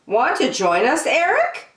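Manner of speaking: high, hopeful mom voice rising; high sweet question from mom; inviting mom. A narrator's reading. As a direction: lure him in gently like a mother.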